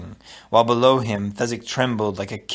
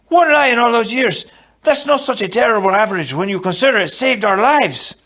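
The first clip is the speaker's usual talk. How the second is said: high, loud, protesting character voice; indignant self-defence; 10 semitones higher, 5 dB louder than usual. A narrator's reading. Inigo defending his one lie to Fezzik, indignant protest. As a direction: argue your case at a high, raised pitch.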